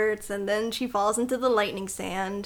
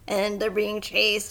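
ordinary talk

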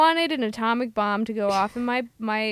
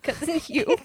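higher, dreamy lovestruck tone; swooning cousin; 5 semitones higher than usual. Retold or imitated. Lovestruck cousin naming her crush, high and dreamy. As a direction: sigh the name like a crush. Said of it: "Cousin Bet's always had the feels".

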